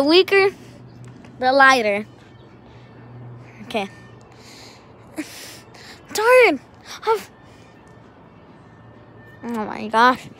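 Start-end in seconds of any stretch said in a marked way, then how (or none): 6.1-7.3 fed-up effort shout; frustrated yell. Real, not acted. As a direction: let it burst out while throwing. Frustrated throws.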